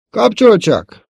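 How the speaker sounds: high, loud, drawn-out reply; calling back. A narrator's reading. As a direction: shout it back to someone downstairs. Luke calls back to his uncle. (hangosan visszakiáltva)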